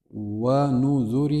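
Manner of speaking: ونذر drawn out very slowly, syllable by syllable; slow model reading. Shown as a demonstration. Teacher first models ونذر slowly for students, drawn out. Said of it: "same word read twice".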